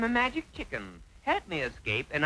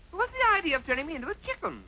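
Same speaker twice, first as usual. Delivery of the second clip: higher, fast, fed-up question; exasperated repeat; 9 semitones higher, much faster than usual. Acted. Lad asking the chicken again, higher and fast with exasperation. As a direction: rattle it off, annoyed nobody answers.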